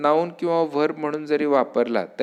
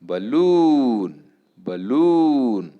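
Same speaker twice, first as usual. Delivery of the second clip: "balloon" with LOON long and swelling, then falling; quick first syllable, drawn-out LOON in "balloon"; stress on second syllable; 4 dB louder, much slower than usual. Shown as a demonstration. Teacher repeating word for students, "balloon" stressed on LOON. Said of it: "on the second syllable".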